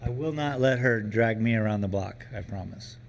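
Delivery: usual speaking voice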